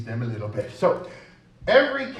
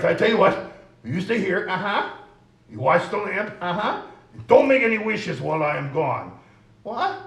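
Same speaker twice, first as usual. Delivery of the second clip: 1 puppet voice; 5 semitones higher, 6 dB louder than usual. Acted. Puppet instructs the other.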